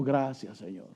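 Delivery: a humble whispered-down thank you; quiet thanks. Retold or imitated. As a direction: murmur it gratefully to the Lord. Thanking the Lord, quoted.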